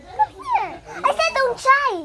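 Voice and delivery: very high sing-song come here; high call. Real, not acted. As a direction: call her like a pet, way up high. Calling the pet. (tawag na napakataas)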